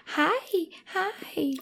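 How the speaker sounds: high, cooing hi to the cat; cutesy baby talk. Retold or imitated. Wife mimicking husband greeting the cat, high and cooing. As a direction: coo it high like greeting a kitten. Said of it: "you're always like".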